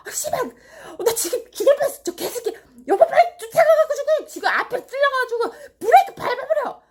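very high, loud, shrieking passenger rage; acted frantic, high-pitched road-rage yelling; hysterical cursing fit. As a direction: throw a hammed-up screaming fit from the passenger seat. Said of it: "더 난리를 치세요".

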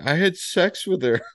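high, tearful mock confession. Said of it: "starting to tear up".

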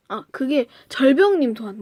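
ordinary talk, nothing marked

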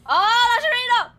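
loud, slow warning call. Real, not acted. Warning of launch.

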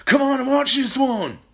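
high, loud holler; excited calling out. A narrator's reading. Mister Kilcranky calling his wife to watch, excited shout. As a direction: shout it across the yard. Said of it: "he called out to Missus Cranky".

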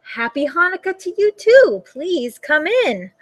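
lightly voiced old Baba, sweet and welcoming; a hint of a delighted old granny at the door; old woman's voice. A narrator's reading. Old woman greeting her guest at the door, sweetly.

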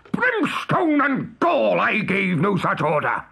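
high, drawn-out cry of rage; enraged. A narrator's reading. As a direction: explode as the furious captain.

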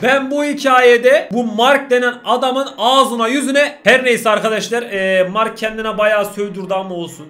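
loud, slow exasperated venting; heated rant. Real, not acted. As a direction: vent at the audience about Mark. Venting about Mark. (izleyicilere sinirle dert yanma)

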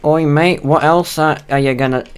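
loud, high put-on British accent; hammed-up British geezer calling out; forced British accent. Teasing his friend with a forced British accent. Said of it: "I can't British when I'm trying to force the British".

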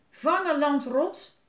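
loud, high, drawn-out holler; pirate call. A narrator's reading. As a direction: call it down from a roof. Pirate calls down while throwing. (luid naar beneden roepen)

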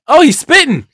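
sudden high loud cry of disgust; jolted by a spitting monster; startled yelp. Real, not acted. Gamer yelps as the monster spits.

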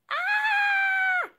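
very high, loud held shriek; piercing, sustained cry of pain; pained scream. Acted. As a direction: scream out long as if stamped on. Elsie screams, toes stamped.

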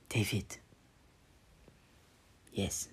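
soft whispered confession; whispered love words. Acted. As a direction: whisper it tenderly.

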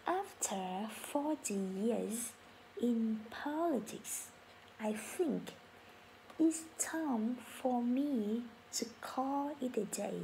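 very slow, syllable by syllable teacher read; slow model sentence. Shown as a demonstration. Teacher reading for class to repeat, very slow. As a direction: drag it out so learners can follow. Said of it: "Everyone say it after me".

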